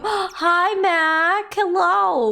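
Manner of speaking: high, loud, delighted hello; gasp, then squeal your hello; excited greeting. Real, not acted. Streamer spots a pretty kitty watching, excited greeting.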